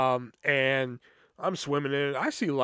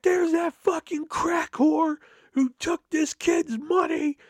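mom's furious yell, much higher, slow and loud; furious shout; 11 semitones higher, 3 dB louder, slower than usual. Retold or imitated. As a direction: scream it across the street at the thief. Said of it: "my mom fucking loses it".

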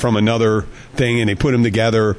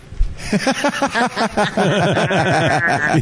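higher-pitched laugh at his own joke; long laugh; 7 semitones higher than usual. Real, not acted. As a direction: laugh long after the punchline.

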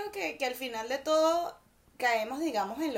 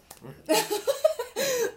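loud, high real laughter; 11 semitones higher, 5 dB louder than usual. Real, not acted. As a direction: dissolve into laughter. Laughing at own skit.

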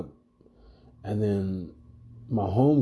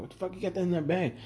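quick, higher, softer question; retold bafflement; 7 semitones higher, 6 dB quieter, much faster than usual. Bouncer quizzing his partner about the bag, baffled. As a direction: fire off a puzzled question under your voice.

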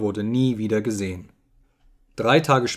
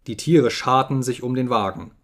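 ordinary delivery, nothing marked

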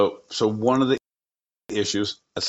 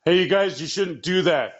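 mock Matt; 8 semitones higher than usual. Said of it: "Matt's like".

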